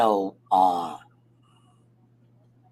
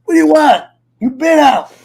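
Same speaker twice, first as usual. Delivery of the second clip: loud, much higher yelling; yelling at dog; 11 semitones higher, 9 dB louder than usual. Real, not acted. To his dog wanting out, loud yelling. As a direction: snap at the dog loudly.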